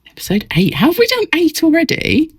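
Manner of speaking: high gasping disbelief; gasp, then ask in amazement; surprised. Pretend. Mock surprise at episode count.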